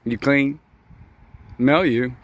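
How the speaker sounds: normal talking voice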